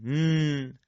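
"نون" as a held nasal n; sound of noon. Shown as a demonstration. Teacher showing students the letter noon, held nasal n. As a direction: hold the n of "نون" evenly.

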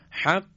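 حق with the qaf cut off sharply; stopped final qaf in حق. Shown as a demonstration. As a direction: clip حق so the air stops at the qaf.

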